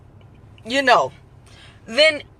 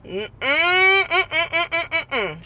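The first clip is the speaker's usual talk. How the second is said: drawn-out, higher put-on aunt voice; long-winded aunt; 3 semitones higher, 4 dB louder, much slower than usual. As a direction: stretch it out like an aunt settling in to unload. Aunt answering a risky question.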